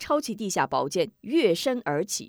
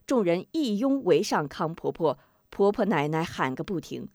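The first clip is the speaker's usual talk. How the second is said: usual speaking voice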